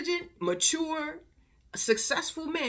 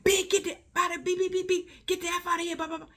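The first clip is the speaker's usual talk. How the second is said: high, loud retold aggression; high-pitched mock tirade trailing into nonsense syllables; mock angry man; 10 semitones higher, 4 dB louder than usual. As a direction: imitate someone charging in and yelling. Imitating man charging at the girl.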